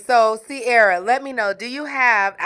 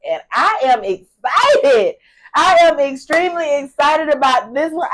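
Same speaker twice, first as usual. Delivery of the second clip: podcast host intro; 5 dB louder than usual. Acted.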